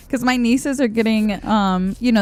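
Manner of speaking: ordinary delivery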